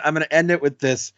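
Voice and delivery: usual speaking voice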